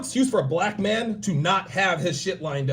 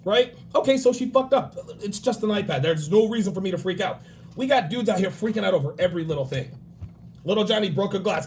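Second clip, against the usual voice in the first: normal talking voice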